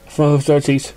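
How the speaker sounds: usual speaking voice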